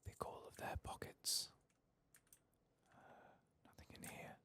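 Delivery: hushed stealthy whisper. Real, not acted. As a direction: creep and keep the voice down. Sneaking through the enemy house.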